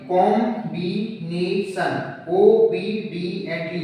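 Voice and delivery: "Combination, ओबीडिएंटली" slowly, each syllable a separate beat. Shown as a demonstration. Teacher showing several beats per word, "combination, ओबीडिएंटली" syllable by syllable. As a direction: chop "combination, ओबीडिएंटली" into slow single syllables. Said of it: "दो से अधिक बार में हो रहा है".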